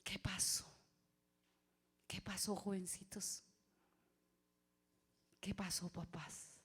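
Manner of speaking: hushed whispered question. Real, not acted. Preacher whispering to a silent congregation. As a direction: whisper it as a gentle challenge.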